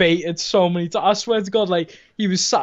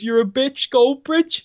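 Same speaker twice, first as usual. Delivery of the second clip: put-on kid's taunt; 7 semitones higher, slower than usual. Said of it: "runs past and goes".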